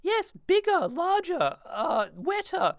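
high-pitched, slow fake moans; mock moaning. Host showing how to perfect your moaning, mock moaning. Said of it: "if you wanna perfect your moaning".

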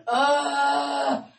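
loud venting groan; fed-up groan. Retold or imitated. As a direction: let out a frustrated break-room groan. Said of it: "just going".

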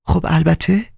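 high, light female voice; Nisa's voice. Acted. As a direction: toss it off as a high coquette.

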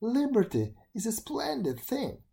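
put-on high, sweet girl's voice; gentle girl. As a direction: cheer the beetle on. Girl frees the beetle.

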